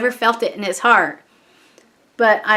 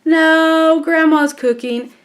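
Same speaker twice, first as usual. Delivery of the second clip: higher-pitched, drawn-out mock reply; imitated son; 5 semitones higher, slower than usual. Son answering mom's question about cooking, mimicked and higher. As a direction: lightly mimic a teenager's dragged-out answer.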